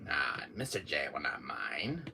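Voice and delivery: low scheming whisper; sneaky whisper. Acted. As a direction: plot it quietly to yourself. Sneaky aside.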